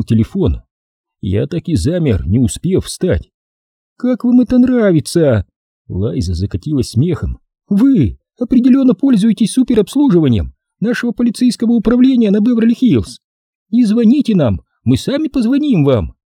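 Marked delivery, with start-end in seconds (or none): 4.0-5.4 high-pitched female character voice, teasing; sassy lady, pitched high; teasing woman. Acted. Лайза laughs at the situation. 7.7-16.1 high-pitched female character voice, teasing; sassy lady, pitched high; teasing woman. Acted. Лайза mocks the situation.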